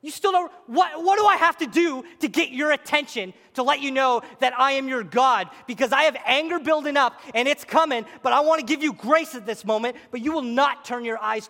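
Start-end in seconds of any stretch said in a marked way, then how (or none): 0.0-5.5 high, loud frustrated outcry; God pleading loudly. Retold or imitated. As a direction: cry out in frustration for attention. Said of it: "He says".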